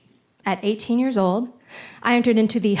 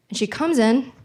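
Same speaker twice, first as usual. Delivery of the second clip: ordinary talk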